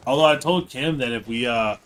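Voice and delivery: ordinary talk, nothing marked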